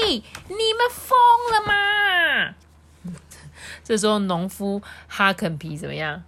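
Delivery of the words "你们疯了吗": slow, much higher shouted question. Acted. The farmer shouts at Dr. Boom and the goldfish. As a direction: yell up at the reckless drivers. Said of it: "突然有人大吼说".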